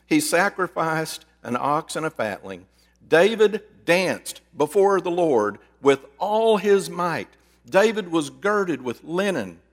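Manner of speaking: slow, measured Bible reading; scripture reading. Acted. Pastor reading Second Samuel to the woman, solemn reading. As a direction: read the verses with weight.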